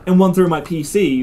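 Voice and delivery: ordinary delivery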